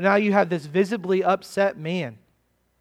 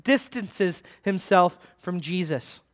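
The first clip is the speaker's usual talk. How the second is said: ordinary delivery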